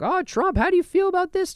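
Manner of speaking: squeaky mock radio host asking; imitating radio host. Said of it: "they would be like".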